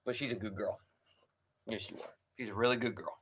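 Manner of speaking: soft, hushed pet talk; praising the dog. Real, not acted.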